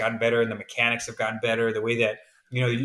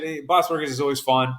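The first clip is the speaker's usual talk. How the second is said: usual speaking voice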